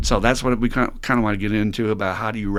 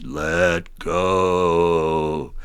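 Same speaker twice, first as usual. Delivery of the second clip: lower, Charlton Heston style voice from above; a grand movie God; booming voice of God; 4 semitones lower than usual. Acted. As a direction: heavenly voice answers the dangling man. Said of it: "the voice just repeats".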